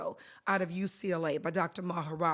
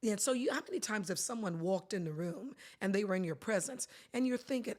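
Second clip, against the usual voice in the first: usual speaking voice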